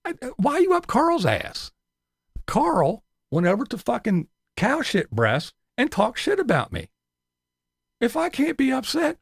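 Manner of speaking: ordinary talk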